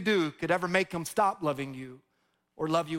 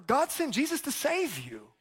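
ordinary talk

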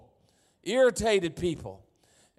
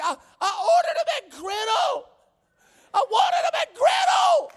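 high, loud, desperate shriek of a frantic customer; imitating yelling lady; 6 dB louder than usual. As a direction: shriek it way up high like she's losing it. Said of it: "She's yelling".

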